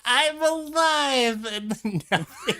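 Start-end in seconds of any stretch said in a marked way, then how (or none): 0.1-1.4 very high, drawn-out hammed-up "I'm alive!"; slow, very high, louder mock cry of coming back to life; mock revival cry. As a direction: stretch it out like a risen corpse, hammed up. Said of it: "you know what they say".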